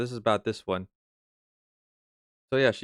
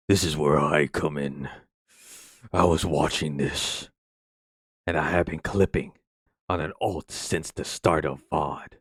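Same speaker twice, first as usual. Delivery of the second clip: much lower, slow put-on voice; weird voice; 6 semitones lower than usual. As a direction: drop way down into a silly, measured character voice. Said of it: "that fucking weird voice that I did".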